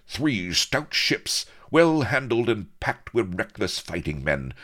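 high, rousing call to arms; daring cry. A narrator's reading. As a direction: sell the raid with bold fire. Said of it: "cried Avery".

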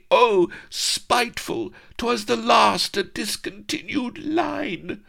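very high, slow wail; hammed-up despairing damsel; wailing dismay. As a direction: wail over the lost pot. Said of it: "Vanity wailed with dismay".

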